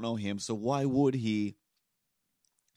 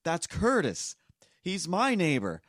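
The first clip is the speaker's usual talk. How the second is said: higher, louder neighbor's voice; voicing tall neighbor; 9 semitones higher, 6 dB louder, slower than usual. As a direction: announce it proudly to the old man.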